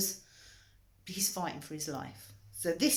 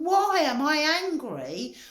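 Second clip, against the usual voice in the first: indignant; 11 semitones higher, 6 dB louder than usual. Retold or imitated. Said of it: "I went".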